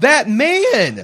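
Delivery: high, loud imitation of a shouting kid; mimicking a small son's loud voice; loud child's outburst. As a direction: blurt it out like an unembarrassed three-year-old. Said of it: "in a loud voice says".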